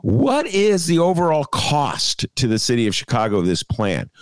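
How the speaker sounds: drawn-out, high "hello", then insistent question; pointed asking. Acted. Talk host voices sceptics questioning the casino plan, pointed asking. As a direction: demand an answer as if nobody is listening. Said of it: "the group that is just asking".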